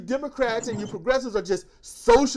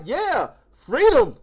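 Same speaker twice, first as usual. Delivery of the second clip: drawn-out, louder mock cheer for freedom; mock crowd cheer; 5 dB louder, much slower than usual. Supporters cheering the president, slow mock cheer. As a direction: stretch freedom out like a cheering fan.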